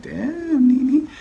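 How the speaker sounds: very high stunned exclamation; shocked reaction. Real, not acted. Vlogger reacting to Nene's outburst, very high pitched. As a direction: gasp her name in disbelief. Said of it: "I'm like".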